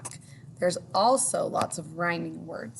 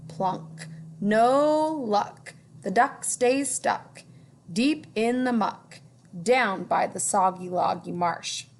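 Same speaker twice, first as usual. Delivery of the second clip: sing-song storybook reading; reading to children; 3 semitones higher, slower than usual. A narrator's reading. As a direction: read it like a picture book. Rhyming story narration.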